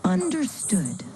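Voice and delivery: normal talking voice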